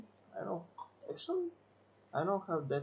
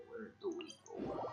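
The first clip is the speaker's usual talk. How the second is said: whisper; 10 semitones lower, 10 dB quieter than usual. Real, not acted. Whispered repeat of own question.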